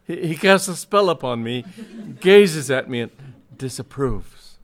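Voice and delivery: high, loud, halting dread; spooked, with breaks between phrases; shaking voice. A narrator's reading. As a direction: stammer it out in haunted agitation. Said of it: "in a shaking voice".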